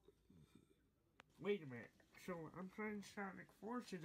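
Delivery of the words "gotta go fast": catchphrase whispered away to nothing; fading whisper. Acted. The catchphrase dying out into a fading whisper. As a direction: let it fade into a breathy echo.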